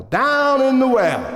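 high, slow swoop; echoing repeat. Acted. Storyteller peers down at the fallen father, echoing repeat. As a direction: stretch it up high, then let it fall.

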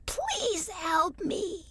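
very high, slow, soft plea; a thirsty little tree begging; tiny apple tree. A narrator's reading. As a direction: beg weakly in a tiny high voice. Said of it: "said the tiny apple tree".

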